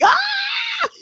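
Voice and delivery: a sudden high yelp of pain, acted out; retold belted scream. Actor retelling his pencil-stab scream to the host, high yelp. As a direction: belt a short pained "Ah!" way up high. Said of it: "I'm belting like".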